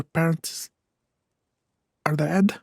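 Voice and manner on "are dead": usual speaking voice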